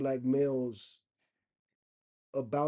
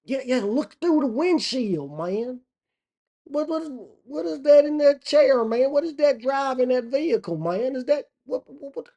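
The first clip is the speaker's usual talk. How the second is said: high, loud, stammering disbelief; hammed-up urgent bewilderment; gawking country local; 11 semitones higher, 7 dB louder than usual. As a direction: play a hillbilly getting more and more worked up. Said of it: "that's how they be looking".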